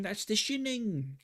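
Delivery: high, slow British caricature, "Shining" as "Shinning"; mock British accent. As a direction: correct it in silly British, short-i "Shinning". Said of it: "British people be like".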